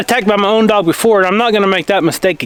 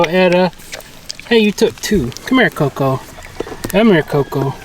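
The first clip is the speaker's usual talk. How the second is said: ordinary talk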